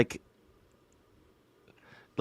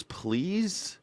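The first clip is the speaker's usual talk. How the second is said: ordinary talk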